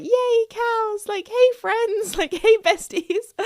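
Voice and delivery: high, giddy greeting with a laugh; excited. Retold or imitated. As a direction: gush at the cows like old friends. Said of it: "I was so excited".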